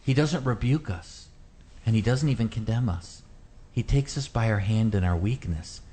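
ordinary talk, nothing marked

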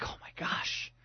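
whispered, retold shock; hushed and stunned; shaken whisper. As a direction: whisper it under your breath in disbelief. Shaken father after the rescue.

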